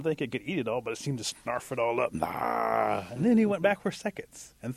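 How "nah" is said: drawn-out snarfing "nah"; imitated gobbling noise. Storyteller showing the creature snarfing it all up, gobbling noise. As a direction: mimic greedy gobbling in one long noise.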